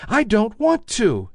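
high, small rabbit voice; little toy rabbit. A narrator's reading. Toy rabbit insisting to the wild rabbit, high small voice. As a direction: pipe up like a tiny toy bunny. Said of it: "he said again".

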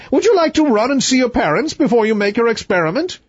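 high, imperious royal voice; haughty king. Acted.

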